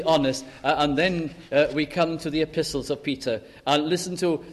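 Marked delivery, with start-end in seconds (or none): none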